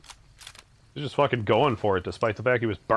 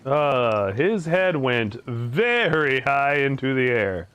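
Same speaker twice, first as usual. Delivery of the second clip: ordinary talk, nothing marked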